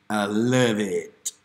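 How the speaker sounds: slow, savoring I love it; drawn-out loving. Acted. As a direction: stretch it out lovingly.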